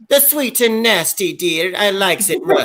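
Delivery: put-on sweetly naughty little old lady. Answering the sauce question in a light granny voice. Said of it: "I said".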